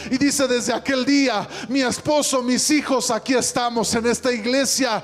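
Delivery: loud, high, fervent climax of the sermon; preaching at full force. Acted. Preacher relating the forgiven woman's joy to the church, fervent and loud. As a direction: build the testimony to a triumphant peak.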